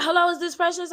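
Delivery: a hint of a bright phone greeting; caller voice. Dress lady calling the bride, lightly voiced polite greeting. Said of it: "They was like".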